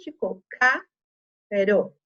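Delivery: "ca-ro" said slowly as two separate syllables, ca then ro; slow teacher syllable split of "ca-ro". Shown as a demonstration. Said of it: "na separação de sílabas".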